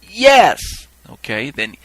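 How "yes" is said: loud, high one-word shout; crowd crying out. Retold or imitated. People answering the reaper, crying out. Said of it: "The people cried out".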